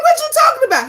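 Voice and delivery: high, loud mock indignation; as if wrongly accused; indignant surprise. Wife answering husband's blame, high and indignant. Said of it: "I'm like".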